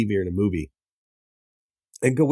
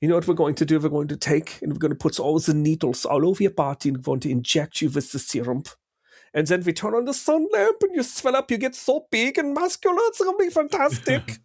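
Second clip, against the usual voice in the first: put-on generic German mad-scientist accent, climbing much higher; German villain; 5 semitones higher than usual. Spoofing the movie's German scientist, hammed-up German accent. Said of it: "can't do a German villain".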